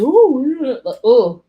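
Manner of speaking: high, loud mimicked ooh. Retelling her reaction to the bear, loud ooh. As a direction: whoop out a sudden ooh. Imitated noise in retold reaction.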